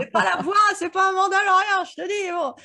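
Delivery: high, loud, laughing insistence; laughing high retort. Retold or imitated. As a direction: squeal it out through laughter.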